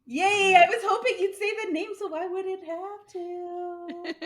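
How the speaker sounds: delighted squeal, high and loud; excited. Real, not acted. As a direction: cheer it out, thrilled. Host cheers at correct guess.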